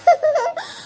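high, short giggle; sheepish giggle. Acted. Child backing down before mother, sheepish giggle.

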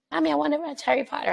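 high, quick imitation of a child; pleading little son. Son asking mom for Harry Potter, childlike plea. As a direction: pipe up like a kid asking mommy.

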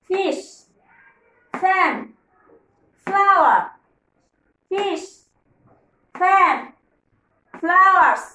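loud call-and-response word drill; drill for repeating. Shown as a demonstration. Teacher drilling kids to repeat, loud chant. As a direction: chant the words for kids to echo. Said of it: "আমার সাথে সাথে বলো বন্ধুরা".

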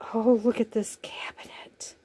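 hushed, breathy marvel at a tiny cabinet; whispered delight over a miniature; awed whisper. Real, not acted. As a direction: whisper it like a secret treasure. Awed unboxing, unvoiced.

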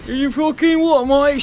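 high, loud, slow Cockney shriek; mock British yell. Streamer mocking screaming enemies with a British yell. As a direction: scream it like an outraged Brit.

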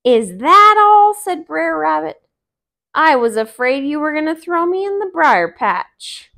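high loud fake relief; mock relieved rabbit. Rabbit tricks the fox, loud fake relief. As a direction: pretend you're not scared at all.

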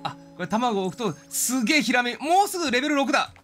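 ordinary talk

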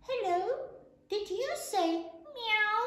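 high-pitched little goat asking; little kid goat. A narrator's reading. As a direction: ask in a high baby-animal voice. Kid asks the cows.

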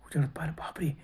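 spooky whispered reveal; hushed whisper. A narrator's reading. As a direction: drop to an unvoiced whisper for the reveal. Narrator reveals the terrible face.

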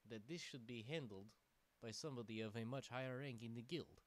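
put-on roleplay the lizard hero in a jokey Russian accent; light fake Russian accent. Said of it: "Buttface the Argonian is now Russian".

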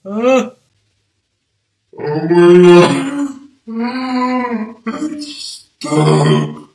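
loud dragged-out oh my God; real alarm as the lash sticks; panicked exclamation; startled cry. Real, not acted. Lash stuck to eye.

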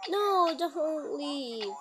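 drawn-out begging; slow pleading. Real, not acted. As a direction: plead slowly, stretched out. Pleading with animatronic.

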